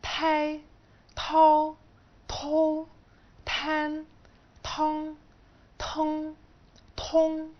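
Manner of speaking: each syllable said slow, high and clear, one by one; pronunciation demo, syllable by syllable; syllable drill. Shown as a demonstration. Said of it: "بعد كده حرف ال".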